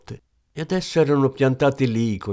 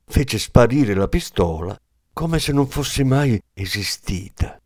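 ordinary delivery, nothing marked

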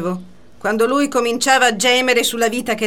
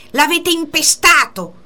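high, loud accusation in the rival's voice; imitated furious cry; retold angry shout; 6 semitones higher, 4 dB louder than usual. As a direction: hurl the accusation at her. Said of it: "urlò lei".

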